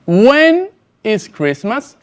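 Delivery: each word spaced out slowly for the learner; slow model sentence. Shown as a demonstration. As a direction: say it slowly and clearly, like a teacher modelling. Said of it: "presta mucha atención". (pregunta modelo, lenta y clara)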